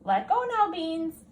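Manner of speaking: put-on high, coaxing little girl; girl playing fetch with her dog, high. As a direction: coax the dog to drop the stick.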